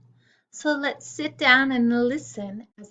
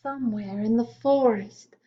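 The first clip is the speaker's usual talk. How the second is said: put-on reading a poem to children. A narrator's reading.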